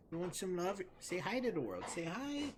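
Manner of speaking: high cooing to a dog; high-pitched doggy talk. Real, not acted. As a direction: coo in a high voice to your pup. Baby-talking to pet dog.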